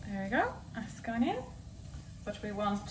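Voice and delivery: ordinary delivery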